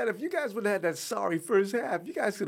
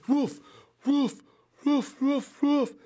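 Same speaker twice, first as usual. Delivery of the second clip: loud, high barking woofs; rhythmic barking chant; dog pound barks; 8 semitones higher, 6 dB louder, slower than usual. Real, not acted. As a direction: bark like a Browns fan in the Dog Pound. Browns fan doing Dog Pound barks.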